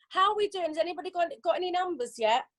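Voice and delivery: ordinary delivery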